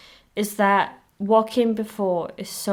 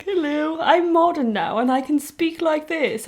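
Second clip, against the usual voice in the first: high, slow, proper mock voice; posh Hulk impression; 7 semitones higher than usual. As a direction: play a prim, overly polite Hulk. Mocking Hulk's new speech.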